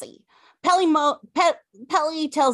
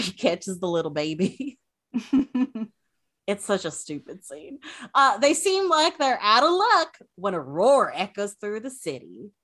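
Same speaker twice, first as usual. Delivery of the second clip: ordinary talk, nothing marked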